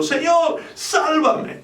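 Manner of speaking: high, pleading cry for help; desperate cry. Acted. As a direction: cry out like a man sinking in the sea. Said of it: "dio voces diciendo". (grito desesperado y agudo pidiendo ayuda)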